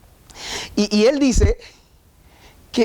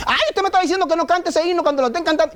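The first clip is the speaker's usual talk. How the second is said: high, fast whining protest from the pews; whiny objector; 8 semitones higher, faster than usual. Pretend. Pastor mimics an offended listener, high fast whine. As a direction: mimic a shocked churchgoer, fast. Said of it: "Yo no estoy diciendo eso".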